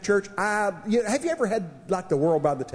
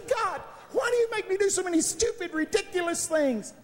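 whining to God; 12 semitones higher than usual. Retold or imitated. Preacher retelling how he whined to God, high and whiny. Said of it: "I was whining to the Lord".

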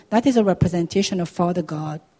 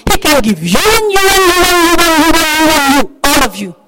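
high, loud, insistent repetition; fervent proclamation; 8 semitones higher, 7 dB louder than usual. Real, not acted. Preacher telling the congregation God can give to each, exuberantly loud. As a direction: call it out to every single listener.

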